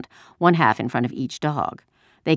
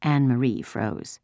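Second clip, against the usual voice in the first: ordinary delivery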